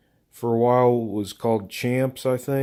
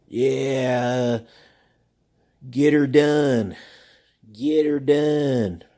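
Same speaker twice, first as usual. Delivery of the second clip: Larry the Cable Guy catchphrase; much slower than usual. Retold or imitated. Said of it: "he used to have this phrase where he'd go".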